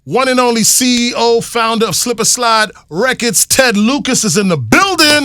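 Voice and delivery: a lightly hyped radio introduction; announcer intro. Acted. Host presenting Ted Lucas on air, light announcer lift. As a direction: build up the guest's name for the crowd.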